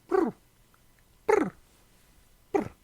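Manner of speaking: trilled brr like a chirping cat; chirpy cat trill. Shown as a demonstration. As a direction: roll a little chirp sound. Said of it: "they'll chirp".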